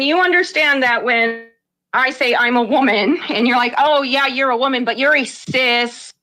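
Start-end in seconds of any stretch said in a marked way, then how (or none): none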